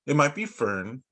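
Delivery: ordinary delivery, nothing marked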